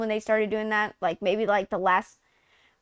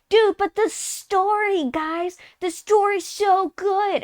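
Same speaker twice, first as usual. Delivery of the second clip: slow, much higher gushing voice; mock gushing fan; 7 semitones higher, slower than usual. Friends gushing about the game's story, high slow mockery. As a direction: drawl it like a starry-eyed fan. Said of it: "everyone was like".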